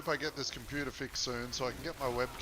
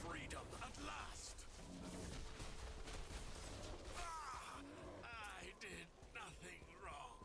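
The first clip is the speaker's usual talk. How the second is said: squeaky voice; 7 semitones higher, 15 dB quieter, slower than usual. Acted. High far voice, likely a clip.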